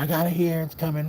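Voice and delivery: high mock waitress promising the food. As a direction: pitch it way up to reassure the customer. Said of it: "She's like".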